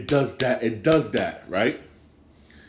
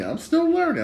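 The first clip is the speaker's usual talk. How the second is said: high sing-song; 3 dB louder than usual. Shown as a demonstration. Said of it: "I don't know much about hockey".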